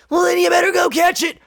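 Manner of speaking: very high, goofy put-on voice; silly joke voice. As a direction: squeal the corny comeback way up high. Silly joke reply to runny nose.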